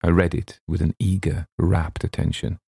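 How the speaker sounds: plain reading voice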